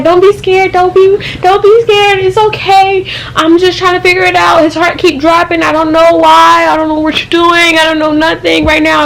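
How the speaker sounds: panicky nurse. Retold or imitated. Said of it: "the nurse scared the shit out of me".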